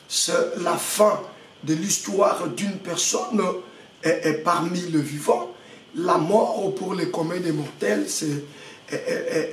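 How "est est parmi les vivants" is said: normal talking voice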